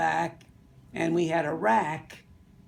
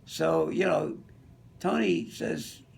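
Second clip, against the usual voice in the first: ordinary talk, nothing marked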